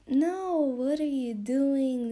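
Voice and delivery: loud, drawn-out, high "no" of disbelief; exasperated protest. Real, not acted. Host telling fans off for romanticizing mafias, exasperated.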